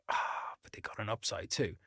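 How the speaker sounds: mock user's whispered excuse; mock drug user. Mocking a drug user to the listeners, whispered. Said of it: "but you're like".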